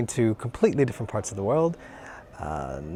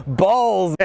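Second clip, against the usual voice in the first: loud, high, blunt mock American; cartoon American accent, punchy and loud; 7 semitones higher, 4 dB louder than usual. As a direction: bark it like a brash American. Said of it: "the American accent's like".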